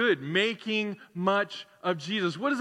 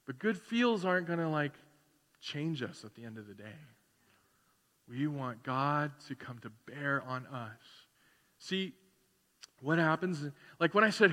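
ordinary delivery

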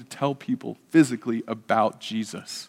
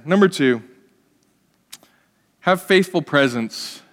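ordinary delivery

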